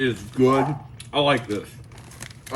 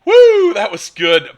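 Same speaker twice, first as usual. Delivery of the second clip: loud excited woo; 8 semitones higher, 10 dB louder than usual. Real, not acted. Recalling the spicy jerky.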